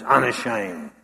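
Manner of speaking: chest out, bark it with pride; barked proudly. Retold or imitated. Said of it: "bark out the words".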